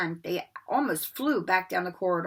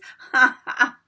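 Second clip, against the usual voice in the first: loud mischievous cackle; poltergeist cackle; 4 semitones higher, 9 dB louder than usual. A narrator's reading. Peeves cackling as he whooshes away.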